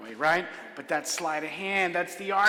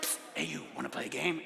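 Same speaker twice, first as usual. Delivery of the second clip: hushed, softer whisper of a street hustler; shady whisper; 4 semitones lower, 12 dB quieter than usual. Retold or imitated. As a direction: lure him in from the dark alley. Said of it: "and he's like".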